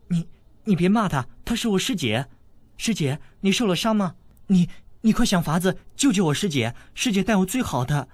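put-on anxious young princess. A narrator's reading. Young princess pleading for her wounded sister, anxious. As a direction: beg him to save her sister. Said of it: "小郡主道".

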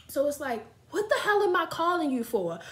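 ordinary talk

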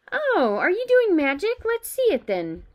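high, loud bossy schoolgirl; pushy know-it-all girl, pitch swooping up. A narrator's reading. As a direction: butt in eagerly and take charge. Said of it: "She had a bossy sort of voice".

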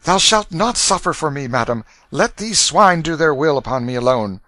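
put-on high, loud, noble boy exclaiming; defiant young prince. A narrator's reading. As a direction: protect her with a brave outcry.

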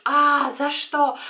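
high, loud, drawn-out wail; hammed-up cry of injustice; mock dismay. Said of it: "и ты думаешь".

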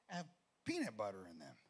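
normal talking voice

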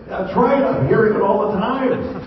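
high-pitched mock complaint. Doctor complaining to his patient, high-pitched mock protest. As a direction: push it high for the comic punchline.

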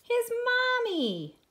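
high, loud, drawn-out reveal; joyful reveal. A narrator's reading. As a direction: stretch it out with delight. Story reveals the bear's mother.

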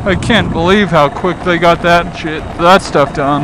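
usual speaking voice

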